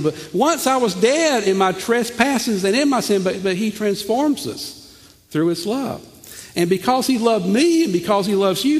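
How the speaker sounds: rising pulpit intensity; preaching fervor. Acted. Preacher building to full force.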